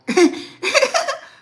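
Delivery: loud high burst of laughter. Real, not acted. Mother cracking up at her son on the phone, loud high laugh.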